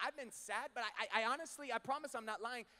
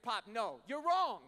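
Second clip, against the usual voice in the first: cutting Dad off, pitch jumping up and louder; a firm raised-voice no, you're wrong; insistent protest; 3 semitones higher, 7 dB louder, slower than usual. Retold or imitated.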